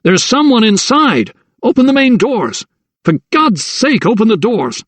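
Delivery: high pleading yell downstairs; desperate shout. A narrator's reading. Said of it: "he shouted down".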